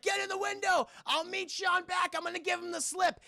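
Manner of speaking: high, urgent holler; imitated hero's yell; retold shouted orders. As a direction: call out instructions over a crowd. Retold orders shouted over horde.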